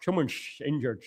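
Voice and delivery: soft, low mock-Dutch with slushy s; attempted Dutch accent. Shown as a demonstration. Testing a player's name on viewers, mock Dutch accent. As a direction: try a slurred, hushed Dutch accent. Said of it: "or is it Dutch? Dutch".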